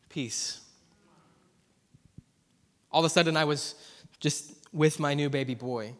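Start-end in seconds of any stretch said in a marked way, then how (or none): 0.1-0.6 low, very soft single word; hushed peace. Real, not acted. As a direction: let it drop to a gentle hush. Preacher recalls sudden calm.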